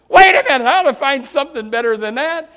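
sudden high, loud objection, then easing; mock alarmed protest. Troubled Bible reader rejecting the verse he landed on, mock alarm. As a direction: burst out in acted dismay. Acts dismay at verse found.

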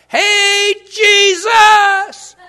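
very high, very loud calling out; yell out. Pretend. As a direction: shout across a crowd to get his attention. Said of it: "You yell out".

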